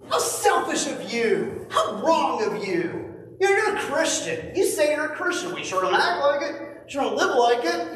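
high, scornful, slowed sneer; a hint of a judgmental churchgoer; mocking critic. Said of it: "Some people are like".

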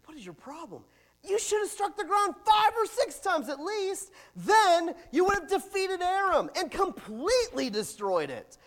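loud, high scolding rant; angry prophet. Acted. Elisha scolding the king for striking only three times, angry. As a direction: tear into him for stopping. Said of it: "Elisha gets angry".

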